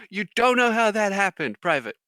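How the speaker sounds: loud, high incredulous mock sergeant; drill sergeant. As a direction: fire the excuse back at the recruit in disbelief. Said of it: "the drill sergeant goes".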